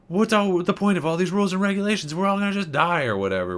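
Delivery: going a bit crazy. Retold or imitated. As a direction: rant like the rules no longer matter. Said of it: "kind of goes crazy".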